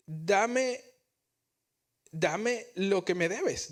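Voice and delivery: grab him and insist on the money; demanding servant. Retold or imitated. The forgiven servant demanding his coworker pay, harsh.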